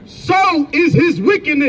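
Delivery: high, slow scripture reading; scripture declamation. Real, not acted. Reader proclaiming scripture at an opponent in debate, declamatory.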